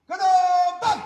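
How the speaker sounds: loud and high, clipped on the last word; sergeant's marching shout; shouted drill order. Acted. Drill commands to hypnotised crowd.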